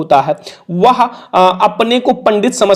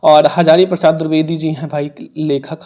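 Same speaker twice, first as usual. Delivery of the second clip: ordinary talk, nothing marked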